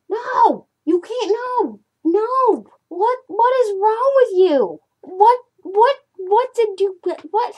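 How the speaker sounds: high, loud frantic protests. Real, not acted. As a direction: fend off the cat in a panic. Fending off the cat.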